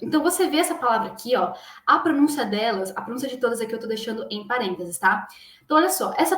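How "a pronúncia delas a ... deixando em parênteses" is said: normal talking voice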